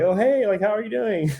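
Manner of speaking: high, put-on cheery hello; forced friendly greeting. Mocking himself greeting strangers at the party, put-on cheery. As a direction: fake upbeat small talk voice. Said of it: "trying to have that energy of like".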